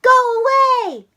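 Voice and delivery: high, loud dismissive shout; cry. Acted. Froggy shouting his little sister away, loud. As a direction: brush her off with a shout. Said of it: "cried Froggy".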